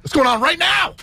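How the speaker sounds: high excited shout. Real, not acted. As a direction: shout it out like a hype man. Hyping live record convention.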